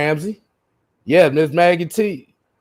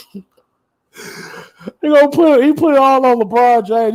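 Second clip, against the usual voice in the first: cracking up; 9 semitones higher, 5 dB louder than usual. Real, not acted. Laughing disbelief at a chat comment.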